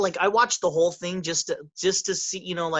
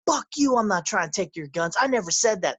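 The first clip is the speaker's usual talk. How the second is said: snapping back, pitch jumping higher; angry retort; 4 semitones higher than usual. Retold or imitated. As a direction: throw it back in his face, hot. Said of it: "so he's like".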